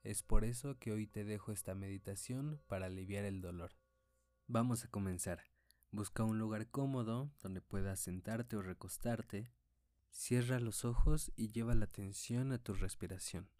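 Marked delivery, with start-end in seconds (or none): 4.5-13.5 calm, unhurried meditation guide; guided meditation. Acted. Leads a meditation. (voz de meditación guiada)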